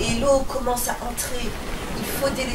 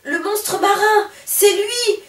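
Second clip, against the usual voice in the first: slow, high, loud cry of fright; terrified yell; 9 semitones higher, 5 dB louder, slower than usual. Acted. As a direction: scream at the monster. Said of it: "hurle Bigoudi".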